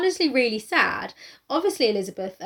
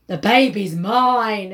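soap opera drama; 2 semitones lower, 7 dB louder, much slower than usual. Retold or imitated. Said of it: "it's sort of like".

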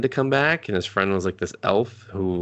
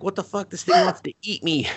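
higher, panicky alarmed father; alarmed dad; 9 semitones higher than usual. Retold or imitated. Dad panicking at the lion in the house, high and alarmed. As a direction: squeal it like a scared dad.

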